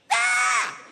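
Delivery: very high, loud scream; acted scream of losing it; sudden high-pitched yell; snapped scream. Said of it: "he snapped. He lost his mind".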